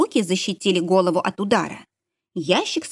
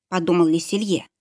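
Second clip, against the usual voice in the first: usual speaking voice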